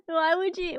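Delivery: normal talking voice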